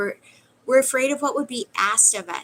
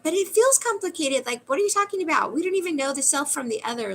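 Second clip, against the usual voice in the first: higher and fast, mock flustered objection; mock protest; 6 semitones higher than usual. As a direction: play the confused student pushing back. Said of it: "we're like".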